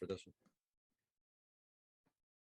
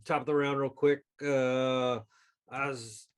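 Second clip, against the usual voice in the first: ordinary talk, nothing marked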